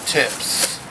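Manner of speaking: ordinary talk, nothing marked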